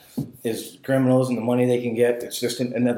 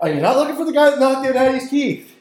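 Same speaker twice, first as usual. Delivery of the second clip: excited retelling; 12 semitones higher, 5 dB louder than usual. Retold or imitated. Acting out own bold question.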